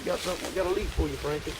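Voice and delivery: high, soft coaxing; coaxing pet voice. Real, not acted. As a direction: sweet-talk the animal with a treat. Offering mule a leaf.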